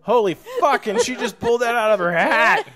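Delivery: high, loud astonishment with a laugh; amazed outburst. Real, not acted. Host astonished by guest's sudden Latin exorcism, loud amazed outburst. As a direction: exclaim it loudly, floored and delighted.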